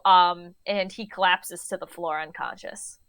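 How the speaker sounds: usual speaking voice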